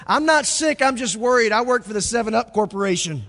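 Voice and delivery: high, loud anxious punchline; worried dad. Acted. Pastor ending the joke, worried dad's loud punchline. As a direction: deliver the punchline fretting.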